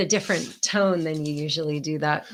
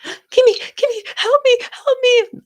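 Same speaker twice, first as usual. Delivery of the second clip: high, loud imitated mother crying for help; mock hysterical sobbing mom; 10 dB louder than usual. Retelling the fake call from her mom, high mock sobbing plea. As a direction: wail it like a panicked mother. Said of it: "her voice sobbing, like, absolutely hysterically sobbing".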